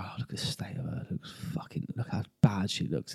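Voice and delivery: hushed catty whisper; mocking whisper. Catty contestants whispering about the fake [name], hushed mockery. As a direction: sneer it under your breath. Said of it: "the girls are sitting and go".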